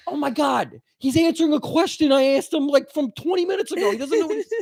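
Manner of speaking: high exasperated rant; exasperated brother. Retold or imitated. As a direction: Tom Cruise's character fed up with Raymond. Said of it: "And he's like".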